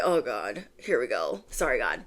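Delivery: mock dismay; muttered dread. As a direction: groan quietly to yourself. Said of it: "I was like".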